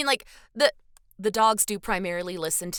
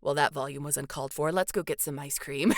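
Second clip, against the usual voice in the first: calm dad making up; 7 semitones lower, 6 dB quieter than usual. Retold or imitated.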